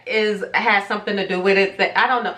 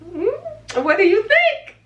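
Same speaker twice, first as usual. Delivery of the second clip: teasing question; 13 semitones higher, slower than usual. Acted. Teasing viewers to guess.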